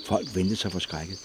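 ordinary delivery

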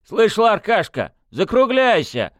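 loud, high, slow shout; stern shout. A narrator's reading. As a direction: bark it out to stop him. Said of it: "прикрикнул Фёдор".

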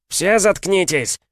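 yelling. A narrator's reading. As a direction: scream it out in pain. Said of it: "заорал я".

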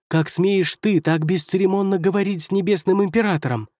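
high-pitched indignant tirade; outraged outburst. Acted. First counselor scolding demon before emperor, outraged.

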